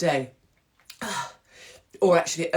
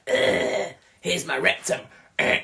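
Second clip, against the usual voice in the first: mock creepy groan; 4 dB louder, slower than usual.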